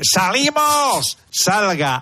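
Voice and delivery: long, high defender's yell; acted shout. A central defender ordering the team upfield, high drawn-out shout. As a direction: call the whole back line out, drawn out. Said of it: "que cuando grite".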